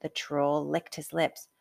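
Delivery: usual speaking voice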